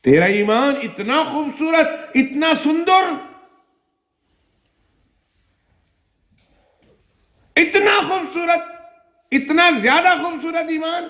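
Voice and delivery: high, rising cries of praise; full-force preacher's exclamation; impassioned preaching. Acted. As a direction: lift the hall with wonder at her faith.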